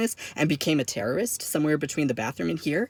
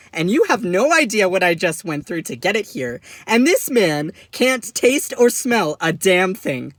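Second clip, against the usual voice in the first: loud, high, indignant tirade; exasperated rant; 4 semitones higher, 6 dB louder than usual. Acted. Son learning his trip was wasted, exasperated rant.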